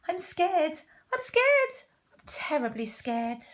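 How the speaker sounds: high squeaks of fright that drop at the end; hammed-up scared cook; acted fear. Cook declares his fear.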